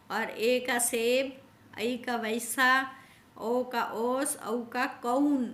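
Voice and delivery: ordinary talk, nothing marked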